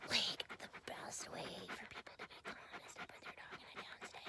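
soft breathy whisper as the dog; dog's inner voice. Acted. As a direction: whisper the dog's wise words. Voicing the dog.

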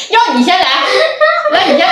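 high laughing, words squeezed out; giggling through the words; stifled giggles. Real, not acted. Laughing fit during the challenge.

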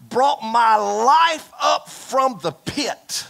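slow, high, loud proclamation; preached shout. Acted. Preacher voices Jonah's rescue, slow and shouting. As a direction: stretch it out and shout it to the rafters.

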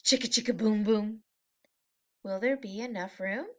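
ordinary delivery

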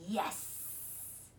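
soft, drawn-out monster hiss; whispery, sibilant monster voice. A narrator's reading. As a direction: hiss it like a sinister creature. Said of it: "he hissed".

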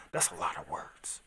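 whispered, under-breath remark; whispered that's a lot of words; whispered aside. Real, not acted. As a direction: mutter it to yourself. Muttered aside while reading.

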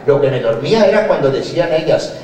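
ordinary delivery

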